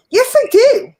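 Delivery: high, loud protest; indignant. Real, not acted. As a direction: insist indignantly. Defending her tank.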